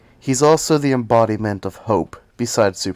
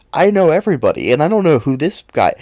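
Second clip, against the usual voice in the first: loud, higher, fast rant; angry outburst; 6 semitones higher, 8 dB louder than usual. Real, not acted. Comic fan ranting at viewers, loud indignant outburst. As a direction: blow up in mock fury. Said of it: "that small outburst".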